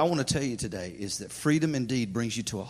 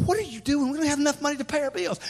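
alarmed husband; 10 semitones higher, faster than usual. Retold or imitated. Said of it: "I sit there and I go".

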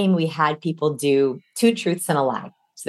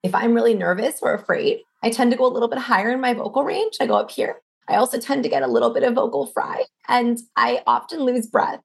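nervous voice; 6 semitones higher than usual. Shown as a demonstration. Coach shows how a nervous speaker sounds, high and strained. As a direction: demo of nerves, higher, creaky and losing breath. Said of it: "in this tone of voice".

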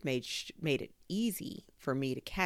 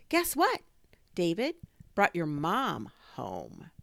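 excited; 3 semitones higher, 6 dB louder, slower than usual. A narrator's reading. Gio's eager news.

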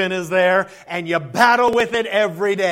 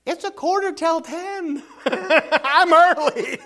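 laughing, slow, higher reply; 6 semitones higher, slower than usual. Real, not acted. Teasing the grumpy man, laughing. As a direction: chuckle out the line, amused.

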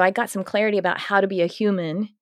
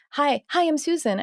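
high-pitched mock introduction; acted greeting; 8 semitones higher than usual. Acquaintance introducing herself at a work event, high retold greeting. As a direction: imitate an acquaintance saying hello, pitched up.

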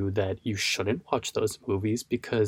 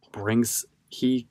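ordinary talk

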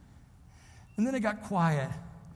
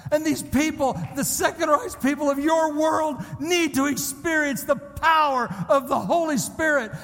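high, driving sermon delivery; fervent preacher building in force; impassioned preaching; 7 semitones higher than usual. Real, not acted. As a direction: urge the congregation on, leaning hard on key words.

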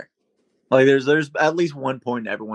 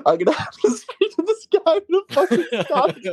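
high laughing voice; 14 semitones higher than usual. Real, not acted. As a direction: laugh through the words. Laughing while telling story.

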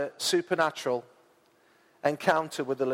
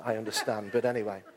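normal talking voice

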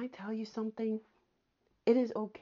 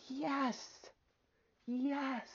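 gentle yes; 5 semitones higher, 5 dB quieter than usual. Pretend. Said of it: "Listen, he says".

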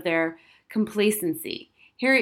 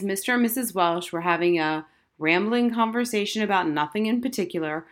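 plain reading voice

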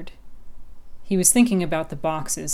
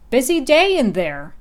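a lightly higher, louder crow call; 8 semitones higher, 9 dB louder than usual. Acted. Crow calls down from tree.

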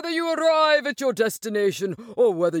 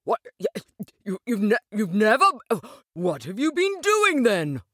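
stammering, incredulous, then higher; open-mouthed astonishment; 3 semitones higher than usual. Acted. Rat questions the boatless Mole, stammering with astonishment. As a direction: stumble over the words in disbelief. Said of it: "cried the rat open-mouthed".

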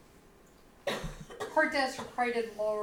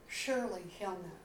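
softer repeat of "Surely he'll know", trailing off; a hushed second "Surely he'll know", half to herself; quiet echo; 2 semitones lower, 7 dB quieter, faster than usual. Shown as a demonstration.

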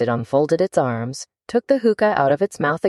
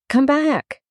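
calling after her; 11 semitones higher than usual. A narrator's reading. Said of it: "the caterpillar called after her".

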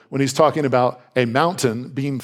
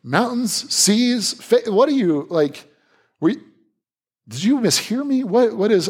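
mock disbelief; 9 semitones higher, slower than usual. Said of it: "especially you're like".

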